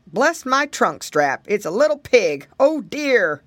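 big, emphatic elephant voice; startled elephant. Acted. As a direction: exclaim as a surprised elephant, drawing out the words. Startled elephant spots a little pig.

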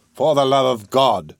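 slow, solemn appeal; earnest, measured entreaty. A narrator's reading. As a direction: implore gravely, weighting each word. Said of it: "said Mister Brownlow solemnly".

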